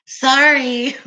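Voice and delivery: ordinary talk, nothing marked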